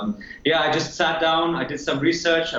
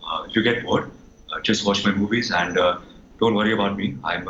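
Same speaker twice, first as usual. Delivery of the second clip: low-pitched, hesitant celebrity impression; low, understated film star addressing fans; Ranbir; 8 semitones lower than usual. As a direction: drop the pitch way down, with ums and pauses. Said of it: "I'll do Ranbir first".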